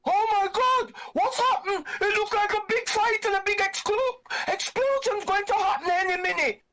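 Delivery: shrill high gasping panic, half laughing; mock panicked onlooker. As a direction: squeal it like a startled, wide-eyed girl. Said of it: "they're like".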